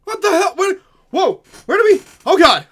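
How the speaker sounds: high, loud frightened yells; panicked shouting. Acted. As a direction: scream in shock at the intruders. NPCs panic at sudden arrival.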